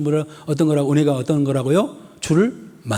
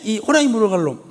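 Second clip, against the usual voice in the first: shouted scolding; 7 semitones higher than usual. Retold or imitated. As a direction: hurl 호랑이 물어갈 놈 at the child like an angry mother. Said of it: "엄마가". (호랑이 물어갈 놈을 높게 소리쳐 꾸짖음)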